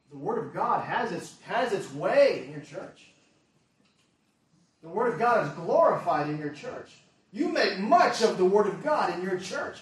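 loud, emphatic sermon delivery; forceful preaching. Real, not acted. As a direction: drive each point home to the congregation. Preaches commendation with raised force.

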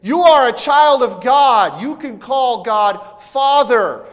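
loud, high, drawn-out shout; crying out. Acted. The Spirit yelling into believers' hearts, loud shout. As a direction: cry it out slowly at full voice. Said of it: "It's the Spirit saying".

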